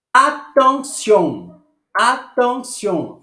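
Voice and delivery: French pronunciation model. Shown as a demonstration. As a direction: stretch "Attention" for students. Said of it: "Atenção".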